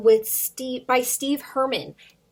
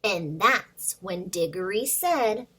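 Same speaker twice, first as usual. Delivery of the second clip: put-on lively storybook read-aloud for little kids; reading to children; 3 semitones higher, 4 dB louder than usual. Teacher reads a picture book to her students, lively and animated. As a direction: bring the picture book to life for the class.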